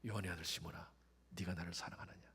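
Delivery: hushed, low asking; very soft question. Acted. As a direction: ask in a near hush. (세 번째로 아주 조용히 묻는 예수님)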